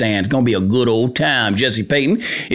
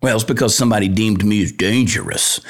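usual speaking voice

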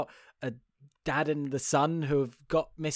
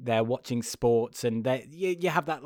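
ordinary delivery

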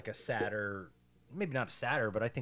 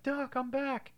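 higher, proud returning hero; mock triumphant Marty; 13 semitones higher, slower than usual. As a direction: announce it like a proud kid. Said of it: "and he's like".

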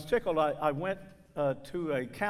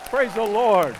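louder call of praise to the church; 4 semitones higher, 9 dB louder than usual. Real, not acted. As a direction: lift the crowd with a loud shout of praise. Calling congregation to praise.

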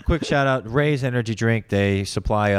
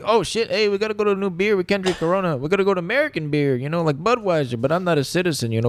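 high, thick mock Spanish accent; 7 semitones higher than usual. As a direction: play a hammy Spanish accent. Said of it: "doing a Spanish accent".

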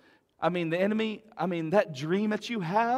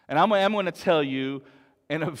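ordinary talk, nothing marked

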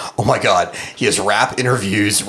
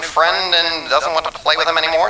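1950s narrator voice; lightly voiced old-time radio announcer; old-timey baseball announcer; 7 semitones higher than usual. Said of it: "Old-timey baseball announcer".

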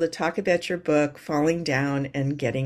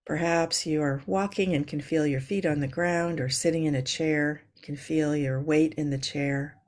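calm grounding exercise. Acted. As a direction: ease them into their breath. Leads a yoga breathing moment.

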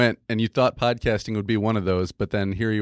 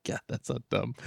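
much softer embarrassed aside; quiet aside; 10 dB quieter than usual. Real, not acted. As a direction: mutter it to yourself. Embarrassed aside under breath.

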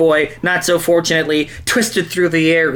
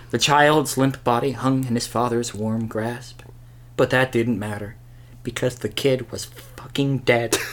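quiet; 6 semitones lower, 7 dB quieter than usual. A narrator's reading. Somber mock-tragic narration.

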